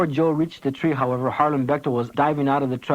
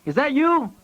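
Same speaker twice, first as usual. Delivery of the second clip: shouting; 13 semitones higher than usual. A narrator's reading. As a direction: shout it out, unsure. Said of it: "Pancho shouted back".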